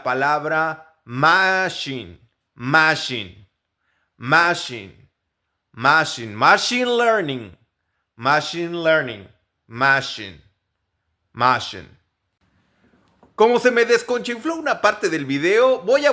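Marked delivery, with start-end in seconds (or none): 1.0-11.9 machine with an sh in the middle. Shown as a demonstration. Teacher drilling machine with an sh sound. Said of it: "el sonido en medio".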